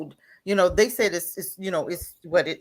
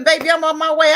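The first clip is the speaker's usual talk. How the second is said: higher, louder urgent reassurance to her son; urgent mom; 6 semitones higher, 8 dB louder than usual. Pretend. Mom on the phone to her frightened son, urgent and loud. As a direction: call out to your boy that you're coming. Mom answering son's call.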